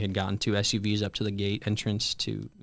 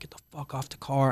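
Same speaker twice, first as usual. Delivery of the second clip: retold broken-English scream; 5 semitones higher than usual. Marine on the car yelling at him, mock scream in broken English. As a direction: imitate a foreigner yelling in broken English, held back. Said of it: "screaming broken English".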